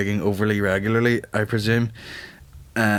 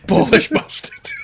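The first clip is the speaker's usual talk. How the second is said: mock northern English accent; 14 semitones higher, 5 dB louder, slower than usual. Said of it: "That accent is the best".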